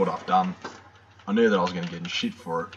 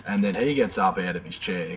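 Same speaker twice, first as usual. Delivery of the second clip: ordinary talk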